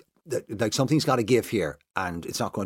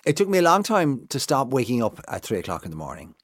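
usual speaking voice

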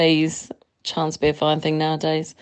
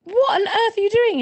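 imitating a much higher shocked cry; shocked outcry. Caller retells catching boyfriend stamping seagull, shocked high cry. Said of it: "And I was like".

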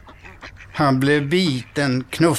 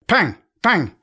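mock gunshots; 6 semitones higher than usual. A narrator's reading. Gunshots in the story.

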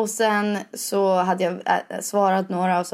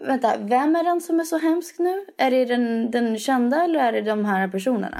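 higher, indignant disbelief; incredulous; 4 semitones higher than usual. Retold or imitated. Reacting to paparazzi harassment with higher indignant disbelief. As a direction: ask it as if it's absurd. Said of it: "Alltså man bara".

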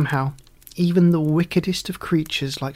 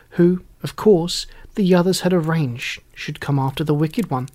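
usual speaking voice

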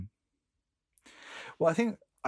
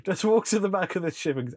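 laughing; 8 semitones higher, 5 dB louder than usual. Real, not acted. Laughing at own scheme.

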